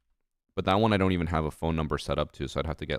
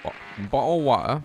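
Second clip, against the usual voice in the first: high, loud mock British accent; 7 semitones higher, 6 dB louder than usual. Shown as a demonstration. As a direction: exaggerate an amateur British accent. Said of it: "practice my accents".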